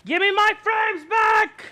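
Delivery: slow, high, loud shout; angry shout. Real, not acted. Streamer shouting at friend who took frames, slow angry yell. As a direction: demand them back, furious.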